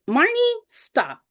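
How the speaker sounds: high, drawn-out "stop it now"; slow, high-pitched admonishing colleague; imitating a friend cutting her off; retold gentle scolding. Colleague cuts off self-doubt.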